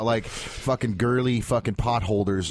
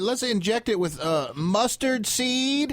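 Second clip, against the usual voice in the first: put-on ditzy girl voice; 7 semitones higher, slower than usual.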